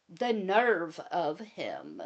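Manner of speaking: slow, huffy sarcasm; mock indignant. Wife joking to viewers about husband, mock huff. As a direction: fake offence.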